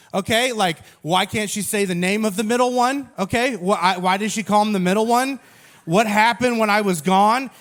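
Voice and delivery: ordinary talk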